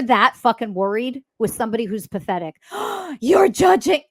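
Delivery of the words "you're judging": high, loud mock outrage after a gasp; gasp, then accuse in hammed-up shock; mock offended accuser. Mocking someone accusing her of judging, high mock outrage.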